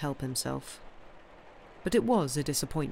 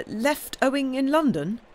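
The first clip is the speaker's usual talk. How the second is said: high, louder, startled question; stammered echo; 9 semitones higher, 6 dB louder than usual. A narrator's reading. Nephew caught out over debts, echoing his uncle in alarm. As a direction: echo it back in rising alarm. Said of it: "stammered he".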